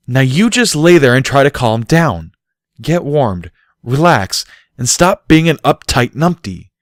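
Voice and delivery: bossy exasperated instruction; stern order. A narrator's reading. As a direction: order him to settle down. Barry ordering Bucky.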